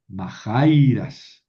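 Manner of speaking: "majairas" con acento en JAI, alargado; acento desplazado. Shown as a demonstration. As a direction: marca fuerte y larga la sílaba "jai" de "majairas". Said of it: "tuve que mover el acento". ("majairas" con el acento en la penúltima, sílaba tónica larga)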